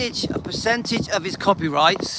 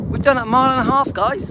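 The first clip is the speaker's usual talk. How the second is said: higher, louder and faster; excited; 6 semitones higher, 4 dB louder, faster than usual. Real, not acted. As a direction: proudly announce the milestone. Repeats distance excitedly.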